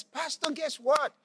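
high-pitched bursting-with-news retelling; excited. Retold or imitated. Mother bringing news to her pastor, excited. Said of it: "she's so excited".

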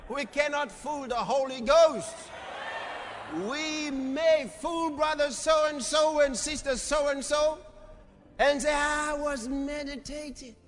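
high, slow, drawn-out preaching. Real, not acted. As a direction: stretch it out to the congregation in a high voice. Preaches with raised voice.

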